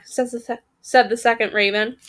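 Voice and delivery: ordinary delivery, nothing marked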